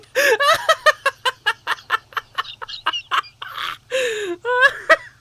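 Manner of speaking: long, loud high-pitched cackle; helpless laughing fit. Real, not acted. Listener cracking up at the story, helpless laughter. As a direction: laugh yourself into high squeals.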